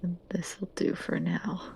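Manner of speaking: slow hushed whisper. Acted. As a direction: breathe it out softly. Character speaking quietly.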